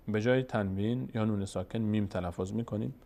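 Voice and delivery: usual speaking voice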